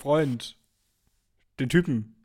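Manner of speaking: low put-on male voice; disguised deep voice. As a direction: drop the voice deep for the character. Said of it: "Ich hab sogar meine Stimme verstellt".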